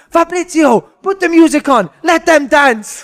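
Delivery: very high, loud mock command; hammed-up boss shouting at his assistant; ranting manager. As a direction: bellow orders like a berserk manager, drawing out the end. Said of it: "turns to his assistant manager and he goes".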